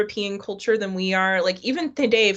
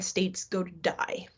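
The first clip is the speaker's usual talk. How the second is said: dramatic hush; 8 dB quieter, slower than usual. Real, not acted. Dreams of Canada dying, hushed.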